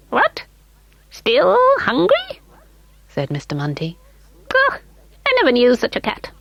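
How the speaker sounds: very high, piping call; high little elf calling. A narrator's reading. Mister Munty voiced.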